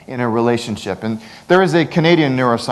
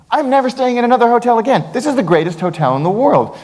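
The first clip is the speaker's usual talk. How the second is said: high, fast, overjoyed; gushing delight; 10 semitones higher than usual. Acted. Speaker to the audience about the hotel, mock gushing. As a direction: rave like a thrilled fan.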